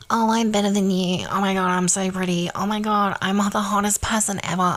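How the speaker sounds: put-on conceited brag; hammed-up self-admiring voice; mock cockiness. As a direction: imitate someone smugly showing off. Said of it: "it's not cockiness".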